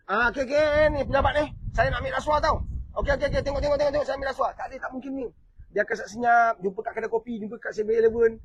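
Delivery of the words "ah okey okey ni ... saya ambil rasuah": raised-pitch mock public announcement; openly announcing a bribe. Bribe-taker absurdly announces himself to a crowd, mock attention-seeking call. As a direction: play a bribe-taker calling for everyone's attention. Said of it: "Kau rasa depan orang ramai ke".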